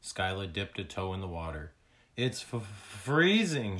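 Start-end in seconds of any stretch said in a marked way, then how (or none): 2.2-3.8 stuttered cry, higher and louder; shivering cry. A narrator's reading. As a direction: cry out as if the cold bites. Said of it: "she cried".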